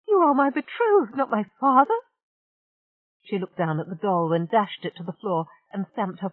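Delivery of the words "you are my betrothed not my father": high, loud indignant protest; indignant outburst. Acted. Rachel protesting to Amos he is no father. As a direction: insist hotly.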